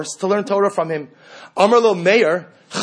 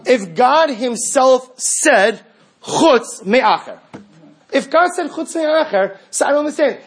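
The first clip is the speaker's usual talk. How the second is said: higher, louder and slow, building in disbelief; incredulous preaching; 7 semitones higher, 3 dB louder, slower than usual. Real, not acted. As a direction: hammer each word as if it makes no sense.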